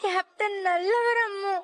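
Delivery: pleading through sobs; child sobbing to mother about Captain; crying child. Acted. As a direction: cry while defending him.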